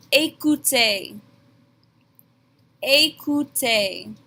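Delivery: Écoutez, ay-koo-tay, both syllables slow; teacher modelling the command; slow French demo. Shown as a demonstration. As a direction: model the word slowly. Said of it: "I'm going to tell them".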